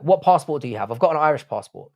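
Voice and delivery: ordinary talk, nothing marked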